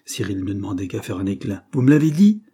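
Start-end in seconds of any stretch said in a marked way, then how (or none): 1.7-2.4 higher, much louder indignant cry; angry outburst. A narrator's reading. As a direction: explode at the rival clerk. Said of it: "s'écria-t-il".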